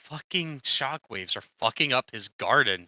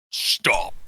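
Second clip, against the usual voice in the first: harsh, voiceless cartoon outburst; Tricky impression; 4 dB louder than usual. Player mimics Tricky coming out of the ground, harsh voiceless cry. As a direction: force out one rasped, unpitched word. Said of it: "it'll be like fucking Tricky".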